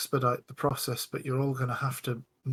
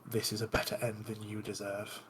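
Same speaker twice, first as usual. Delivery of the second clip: low-voiced, much softer murmur; under the breath; 3 semitones lower, 10 dB quieter than usual. Caster to her victim as the fireball goes, under her breath. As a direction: say it under your breath, just audible to a companion. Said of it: "under my breath".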